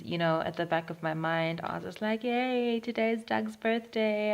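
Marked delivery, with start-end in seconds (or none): 2.2-4.3 slightly raised, drawn-out yay; mild retold cheer. Vlogger recalling her thoughts on Doug's birthday, mildly cheery.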